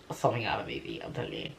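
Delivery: breathy hushed whisper, fading out; whispered aside. Real, not acted. Telling listeners about mum's rescue, hushed whisper. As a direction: lean in and whisper it like a secret.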